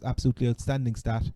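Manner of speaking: normal talking voice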